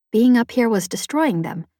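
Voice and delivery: ordinary delivery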